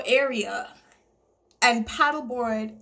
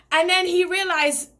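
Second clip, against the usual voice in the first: normal talking voice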